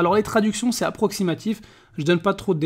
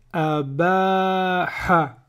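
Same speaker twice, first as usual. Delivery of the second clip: abaha stretched out very slowly; slow blending; much slower than usual. Shown as a demonstration. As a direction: join the syllables slowly into abaha. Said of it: "ça fait".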